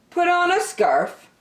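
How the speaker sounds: ordinary delivery, nothing marked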